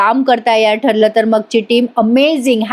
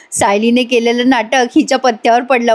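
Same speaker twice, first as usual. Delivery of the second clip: normal talking voice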